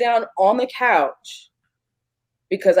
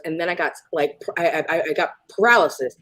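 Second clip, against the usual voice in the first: normal talking voice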